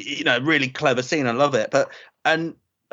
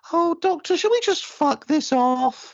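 mock Sarah Jane; 12 semitones higher, slower than usual. Imitating Sarah Jane wanting out.

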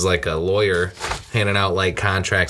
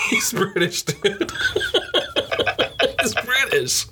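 higher pitch, laughing through the words; 13 semitones higher, slower than usual. Real, not acted. Laughing at a friend who mistook the accent, giggling. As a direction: giggle it out, breathless.

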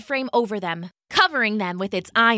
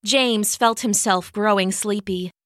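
ordinary delivery

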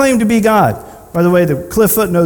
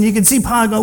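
normal talking voice

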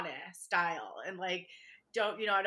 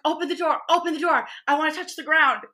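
high, loud and eager, begging to get off; mock-frantic plea to step outside; excited pleading; 7 semitones higher, 8 dB louder than usual. On her first trip overseas, eager to touch the ground, loud excited pleading. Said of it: "I was like".

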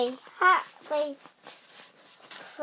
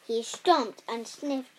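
ordinary delivery, nothing marked

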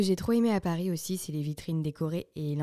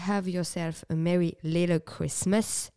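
slow English with a French accent; careful attempt at English, French accent showing; shaky French-accented English; slower than usual. Shown as a demonstration. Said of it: "je mise pas sur mon accent anglais".

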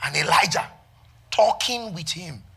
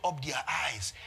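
ordinary delivery

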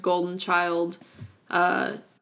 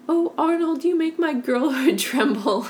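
dreamy lovestruck voice; 8 semitones higher than usual. Retold or imitated. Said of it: "the actual line is".

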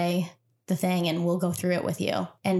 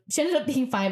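usual speaking voice

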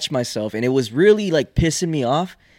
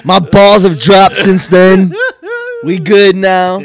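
slow, loud, higher-pitched retort; loud comeback; 7 semitones higher, 12 dB louder, slower than usual. Real, not acted. Comic answers teasing about his voice, loud retort. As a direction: call it out over the laughter.

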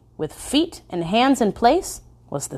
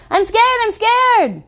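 put-on high, louder panicky little girl; frightened child; 4 dB louder, slower than usual. A narrator's reading. Little girl panicking about the moose, high and scared. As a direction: repeat it as a scared small child. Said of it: "she said".

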